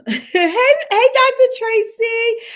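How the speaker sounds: high, loud, drawn-out hey hey; host loudly greeting a viewer in the chat; excited greeting. Real, not acted. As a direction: call out delightedly to a friend.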